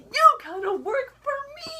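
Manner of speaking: high-pitched, triumphant demand; mock gloating. Hades springing a signed deal on Rumple, gloating. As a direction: crow it as a hammed-up villain.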